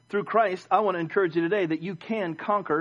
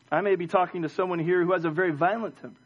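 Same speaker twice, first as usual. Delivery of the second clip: ordinary talk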